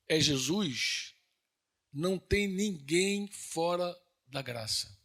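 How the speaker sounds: slowed-down sermon emphasis; slow solemn declaration. Acted. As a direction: stretch each word out slowly. Solemn point about grace.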